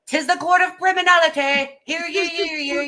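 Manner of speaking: high, loud town crier call; court crier. Pretend. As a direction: proclaim it like an old herald. Mock court crier announcement.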